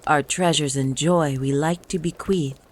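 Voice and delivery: ordinary delivery, nothing marked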